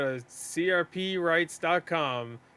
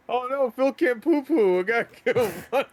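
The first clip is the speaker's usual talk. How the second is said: high, fast mock pity; 7 semitones higher, 5 dB louder than usual. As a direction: whine it out as a pitying fan. Mocking a sympathetic donor.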